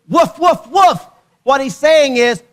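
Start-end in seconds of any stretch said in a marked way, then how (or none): 0.1-1.1 three high loud mock woofs; imitated excited barks. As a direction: yap like an overexcited dog. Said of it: "Your dog goes".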